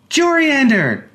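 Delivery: put-on high child's call, ringing out loud; bright shouted name from a small boy. A narrator's reading. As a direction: shout his name like an eager little boy. Child calls out.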